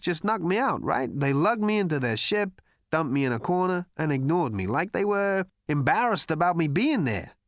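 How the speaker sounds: wry, self-mocking storyteller; Zaphod's voice. Zaphod telling Slartibartfast about the robots, sardonic.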